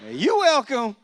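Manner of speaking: high, loud, proud mother; mama's smug voice. Retold or imitated. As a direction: deliver it like a smug mom taking credit. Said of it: "she leaned over to me and was like".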